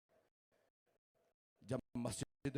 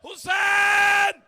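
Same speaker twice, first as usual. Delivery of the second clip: full-force mourning recitation; 11 semitones higher, 13 dB louder than usual. Acted. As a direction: cry the masaib out to the whole majlis. Majlis lament at full force. (पूरे ज़ोर से मसाइब पढ़ना, ऊँची चीखती आवाज़)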